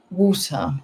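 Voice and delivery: normal talking voice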